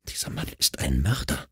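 soft, choked-back tears. A narrator's reading. Evi to Mark about the killer, choked and soft. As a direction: barely get the words out, choking. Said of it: "mit erstickter Stimme".

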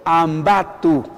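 Ambato drawn out very slowly for a class; slow teacher's Ambato ending in 'tu'; repeat-after-me demo. Shown as a demonstration. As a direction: lead learners through Ambato, syllable by syllable. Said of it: "A ver, juntos conmigo".

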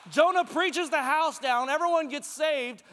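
ordinary talk

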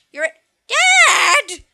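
put-on laughing child shout. Voices son, laughing shout.

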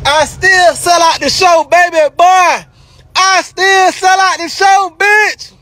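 gloating yell, pitch up high; triumphant shout. Real, not acted.

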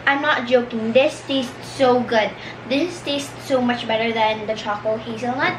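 normal talking voice

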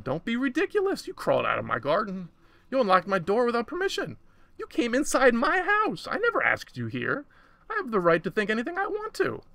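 high, forceful scolding; indignant rebuke. A narrator's reading. Woman accuses the intruder in thought, high indignation. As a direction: tell it off, pitch leaping up.

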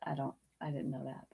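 usual speaking voice

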